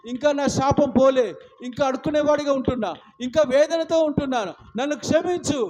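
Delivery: pleading, earnest prayer voice; fervent prayer. Acted. Preacher praying before the church, pleading. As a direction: pray aloud with rising fervour.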